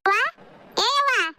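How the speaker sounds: high, drawn-out call; calling out to mother. Acted. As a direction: call "avva" long and high. Calls out to mother.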